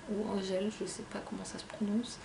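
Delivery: whispered aside. Real, not acted. (aparté chuchoté)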